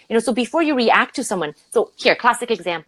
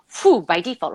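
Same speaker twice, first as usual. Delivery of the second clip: ordinary talk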